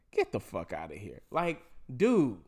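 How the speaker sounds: ordinary talk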